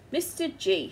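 a wife who has had enough; sternly summoning her husband. Acted. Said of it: "she said sternly".

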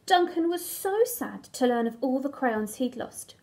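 ordinary delivery

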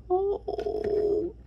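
high squeaky whisper of delight; squealing whisper. Real, not acted. Fan gushing over the figure's hat, high whisper. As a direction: squeal it under your breath.

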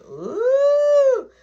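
high, loud, long mock ooh; teasing ooh. Piper reacting to the confrontation, high loud ooh. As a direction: stir the drama with a drawn ooh. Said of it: "So Piper like".

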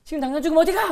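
loud demanding question; interrogating. Retold or imitated. Angry boy grilling a classmate about church, loud.

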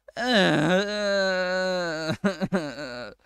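drawn-out frustrated cry; long wavering wail. Real, not acted. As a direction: let out a long, wobbling wail.

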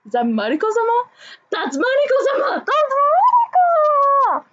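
retold fangirl freakout. Fan reliving her double take at the trailer, building to a squeal. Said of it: "I was like".

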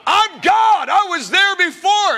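high, loud declaration as Jesus; preaching shout. Acted. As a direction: roar it like a revelation.

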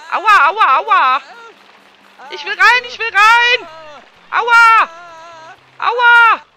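voicing the Sim, high and loud in pain; pained yelling. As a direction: yell it like hail is hitting your head. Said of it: "Er schreit jedenfalls ganz mächtig".

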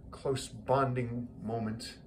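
ordinary talk

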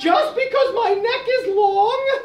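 high, giggling giraffe voice; shy giraffe. Acted. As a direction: laugh it off in a high voice.

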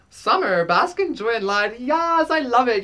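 light up with joy at the card; delighted. Real, not acted. Reader delighted by the summer card, excited.

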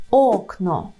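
окно with a stretched, stressed first syllable. Shown as a demonstration. Teacher models the Polish word, stress on ок in окно. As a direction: lean on ок in окно, let но fall. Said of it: "Передостанній склад ок".

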